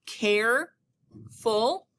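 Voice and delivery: careful drawn out, care held long; teacher stretches careful to show syllable break; stretched syllable. Shown as a demonstration. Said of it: "That very first syllable".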